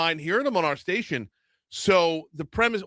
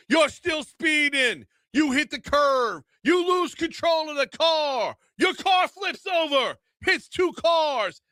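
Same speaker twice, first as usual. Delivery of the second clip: over-the-top ad voice; 4 semitones higher than usual. Retold or imitated. Host acting out a lawyer ad, dramatic. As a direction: ham up a lawyer ad's crash story. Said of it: "doing the voice".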